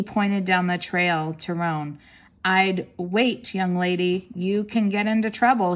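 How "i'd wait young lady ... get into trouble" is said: ordinary delivery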